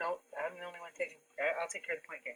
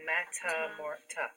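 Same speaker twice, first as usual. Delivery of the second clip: "metamor-" slowly split into its syllables, then "ta" alone; much slower than usual. Shown as a demonstration. Teacher asks for the next piece, "metamor-" in slow separate syllables. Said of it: "What's our next piece?".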